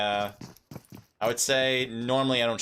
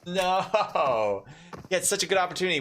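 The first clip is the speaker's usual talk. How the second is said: excited groan; 5 semitones higher, slower than usual. Real, not acted. Caster reacts to missed chance.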